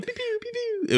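very high, soft imitated laser pew pew; laser pew sounds. Describing the old sound drop, imitating high soft laser zaps. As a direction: squeak out toy laser zaps.

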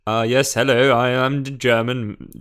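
hammed-up tiger speaking German; a hint of a German-speaking tiger host; tigerish German. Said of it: "a sort of a tigerish German".

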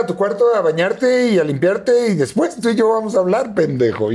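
regaño agudo y firme, más alto de tono; mamá seria castigando al hijo. Retold or imitated. (mamá manda al hijo a bañarse, regañando con voz aguda)

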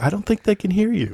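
high-pitched, unbothered reply; imitated oblivious driver. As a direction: play a clueless driver in a high voice. Oblivious driver answers frantic passenger.

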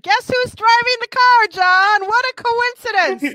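high, loud feigned surprise; hammed-up disbelief; mock amazement. Mock surprise at the film's coincidence.

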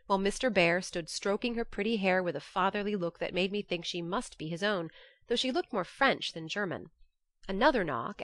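plain reading voice

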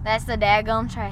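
put-on Southern accent. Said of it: "That's the worst Southern accent".

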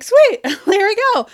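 high, loud, laughing cheer; excited. Retold or imitated. Recalling the book landing in the inbox, excited. Retold delight at email arriving.

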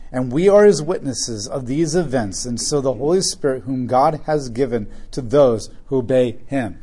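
scripture reading. Acted. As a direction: read the passage out to the church. Reads scripture aloud to congregation.

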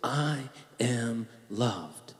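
very soft and slow, each word drawn out; hushed, drawn-out I am loved; slow soft affirmation. Shown as a demonstration. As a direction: model it gently for them to repeat. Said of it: "Say it with me".

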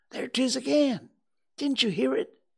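startled by a sound in the dark; alarmed. Acted. Boy hearing graveyard noises, frightened and high. Frightened boys hear something.

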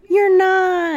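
high, loud insistent protest. Retold or imitated. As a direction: blurt it out in protest. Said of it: "They're like".

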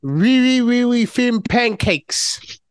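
put-on a failed French accent, stretching the words; bad French accent. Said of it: "That was a terrible impression".

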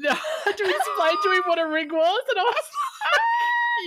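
loud, high-pitched laughing retelling; barely getting it out through laughter; cracking up. Real, not acted. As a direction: laugh helplessly through the words. Cracking up retelling an awkward mix-up.

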